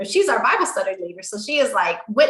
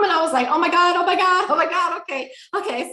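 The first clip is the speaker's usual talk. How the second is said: high, rushing retold panic; flustered, breathlessly repeated exclamation; overwhelmed shock; 8 semitones higher, faster than usual. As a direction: replay the flood of shock, pitched high, settling on okay. Reacting to sudden motherhood news.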